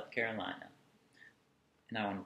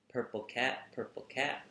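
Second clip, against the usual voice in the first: ordinary delivery, nothing marked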